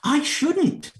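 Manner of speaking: high-pitched indignant protest. A narrator's reading. Alice objects to being told she would go out, indignant. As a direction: pipe up high, affronted. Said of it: "said Alice indignantly".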